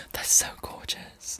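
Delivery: slow, hushed and breathless; slow awed whisper. Real, not acted. Moved by the lyric, a slow whisper. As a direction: let it fall out slowly in a whisper.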